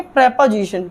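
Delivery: "प्रीपोजिशन" as prep-o-zishan, falling; short E at the start of "प्रीपोजिशन"; correct pronunciation. Shown as a demonstration. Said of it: "सही तरीके से pronounce". ("प्रीपोजिशन" की शुरुआत प्रे से)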